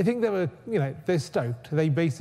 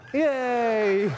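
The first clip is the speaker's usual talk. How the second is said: excited cheer; 7 semitones higher than usual. Real, not acted. Program runs, cheers.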